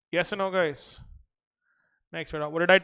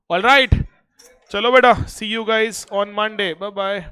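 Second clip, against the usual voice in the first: higher, louder send-off; cheerful loud goodbye; 4 semitones higher, 8 dB louder than usual. Real, not acted. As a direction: call out goodbye to class. Loud cheerful farewell to class.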